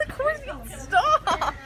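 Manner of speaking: high squealing laughter, pretend scared; laughing mock panic. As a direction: squeal and giggle like you're scared. Friend spooning her, playful panic.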